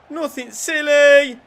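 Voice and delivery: high, loud, drawn-out warning; alarmed warning. Real, not acted. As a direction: stretch it out and shout it at the players. Same plea turns alarmed.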